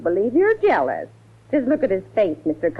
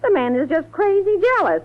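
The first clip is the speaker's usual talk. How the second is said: indignant exclaim; 10 semitones higher than usual. Acted. Housekeeper indignant about Max.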